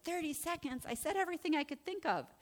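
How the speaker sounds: high, incredulous squeak; retold disbelief. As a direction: protest quickly in mock astonishment. Acted disbelief at the clock.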